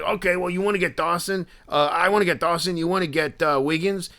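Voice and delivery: high, insistent dude voice; intense Philly dude. Retold or imitated. Collector planning autographs with two fans, high dude impression. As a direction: imitate the guy dividing up the plan. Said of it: "the impression that I was doing of him".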